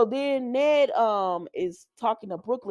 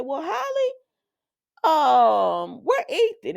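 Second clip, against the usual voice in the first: high, sweet questioning; a hint of a chirpy friend; lightly voiced Felicia; 5 semitones higher, 4 dB louder, slower than usual. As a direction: Felicia asking Holly about Ethan.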